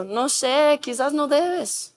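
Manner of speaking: soft, hesitant inner voice; weakened conscience. Retold or imitated. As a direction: trail along slowly and unsure, barely objecting. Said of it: "la conciencia que estaba gritando va a estar diciendo". (conciencia débil, en voz baja y dudosa)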